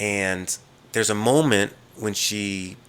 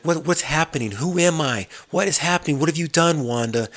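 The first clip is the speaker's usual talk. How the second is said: higher, mock-panicked questioning; confused and frightened, voice pushed up; panicked questions; 8 semitones higher than usual. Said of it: "and he's like".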